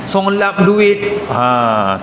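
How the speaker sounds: ordinary talk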